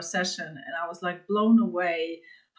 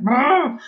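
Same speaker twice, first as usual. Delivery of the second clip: a short, loud, higher frustrated growl with a laugh in it; mock rage growl; 6 semitones higher, 9 dB louder than usual. Imagining screaming in the forest, she growls loudly, half laughing. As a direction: let out a playful mock-angry burst. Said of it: "just scream all I can and just punch something".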